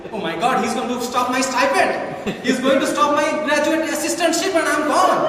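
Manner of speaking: high, loud, panicked rant; student fearing advisor will cut stipend, hammed-up panic; mock panic. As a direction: spiral into comic panic.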